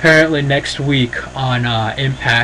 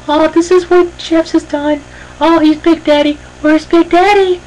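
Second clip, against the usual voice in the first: mock Karen greeting; 4 dB louder than usual. Karen welcomes viewers to the house, in a mock high hostess voice. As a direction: play the gushing TV hostess showing off her house.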